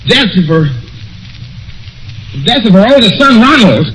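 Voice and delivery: high, breaking, stammering horror; the floor drops out as he hears he's dead; horrified disbelief. Acted. Caller learns his own death from the housekeeper, high with horror.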